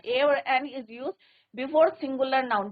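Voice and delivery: slow, word by word, for copying; slow dictation. Shown as a demonstration. Teacher reads the rule aloud slowly for the class. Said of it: "teacher dictating the rule".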